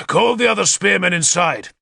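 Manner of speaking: ordering. A narrator's reading. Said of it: "Arthur ordered Issa".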